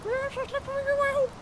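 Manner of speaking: tiny, very high squeaky voice; Larry's voice. Acted. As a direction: answer as a squeaky little character. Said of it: "he said he slept very well".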